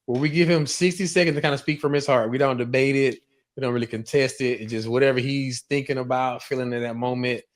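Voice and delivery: ordinary talk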